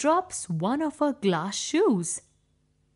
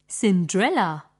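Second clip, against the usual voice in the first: usual speaking voice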